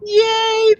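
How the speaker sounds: high, bright burst of delight; excited cheer. Real, not acted. Host cheering her guest's reading, a high delighted yay.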